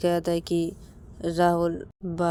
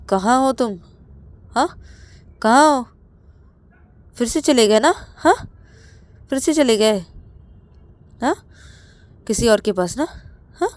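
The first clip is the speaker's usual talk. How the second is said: shouted accusing questions, much higher and louder; jealous husband yelling into the phone; angry shouting; 6 semitones higher, 11 dB louder than usual. Acted. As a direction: yell down the phone at a cheating partner. Ravi confronts Rahul on phone.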